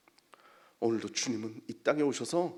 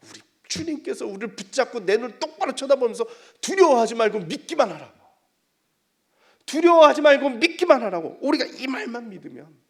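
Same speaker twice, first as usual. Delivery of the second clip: loud, high, emphatic proclamation; forceful preaching; 11 semitones higher, 14 dB louder than usual. Real, not acted. Preacher quotes Jesus urging faith, forceful preaching. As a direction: drive the command home to the congregation.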